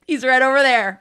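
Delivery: high, loud, smiling smugness; imitated cheerful gotcha; big smile. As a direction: point out the boss with gleeful relish. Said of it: "I had a big old smile on my face".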